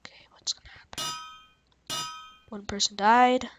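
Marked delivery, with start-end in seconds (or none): 0.0-1.0 tense hushed whisper. Real, not acted. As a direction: whisper it while holding your breath. Tense moment in the fight.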